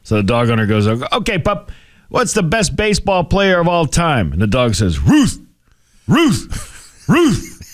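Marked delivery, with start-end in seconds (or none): 0.9-4.3 higher and slower, coaxing the pup; coaxing dog owner. Retold or imitated. Owner coaxes his dog with a second question, higher. 5.0-7.6 high barked yelps of Ruth, rising; dog barking words. Retold or imitated. Talking dog answers baseball question in high barks. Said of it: "He's barking again".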